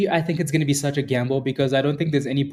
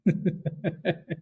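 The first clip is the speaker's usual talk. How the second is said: high, helpless giggle; real tickled laughter; laughing; 4 semitones higher, 5 dB quieter than usual. Real, not acted. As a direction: giggle on in a long run. Laughing at the teasing.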